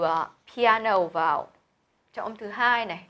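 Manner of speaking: ordinary talk, nothing marked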